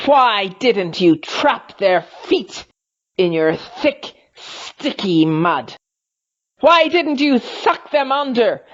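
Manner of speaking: high, loud furious creature voice; angry Snagron. A narrator's reading. Snagron rages at the silent pool, furious. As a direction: rage at the pool, rising.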